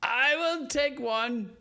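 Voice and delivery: high, loud, drawn-out declaration; emphatic announcement. Real, not acted. Player claims a point of inspiration, loud and drawn out.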